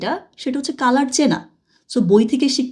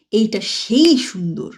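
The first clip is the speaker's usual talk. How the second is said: সেই drawn out and stressed, slow; slower than usual. Shown as a demonstration. Teacher showing students how to stress সেই, slow. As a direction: lean on সেই when you say "এইটা সেই সুন্দর". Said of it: "একটা জোর দিই না?".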